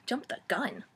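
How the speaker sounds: breathy whisper, almost unvoiced; whispered aside. Real, not acted. Vlogger whispers a snarky aside to viewers. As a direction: lean in and whisper it.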